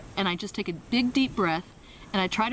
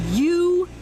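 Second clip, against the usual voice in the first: loud, higher jab of "You"; loud, higher pointed "You"; sharp call-out; 10 semitones higher, 7 dB louder than usual. Retold or imitated. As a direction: bark "You" at him into the mic. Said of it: "she's going like".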